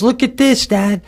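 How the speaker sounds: a hint of an excited child calling her dad; little girl calling.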